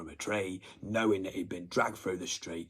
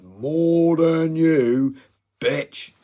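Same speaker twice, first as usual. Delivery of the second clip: loud slow drawn-out taunt; drawn-out loud jeer; taunting yell; 6 semitones higher, 10 dB louder, much slower than usual. Real, not acted. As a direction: stretch it out, mocking and loud. Taunting threat at target.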